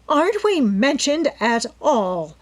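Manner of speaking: greedy widow interrupting the reading; sharp interruption. A narrator's reading. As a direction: cut in indignantly. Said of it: "Missus Topham broke in sharply".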